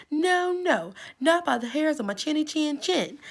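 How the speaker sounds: little pig squealing no from the brick house; squeaky little pig. Acted. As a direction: squeal the refusal high. Pig refuses wolf third time.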